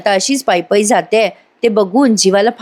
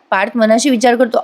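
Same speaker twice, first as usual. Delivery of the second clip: plain reading voice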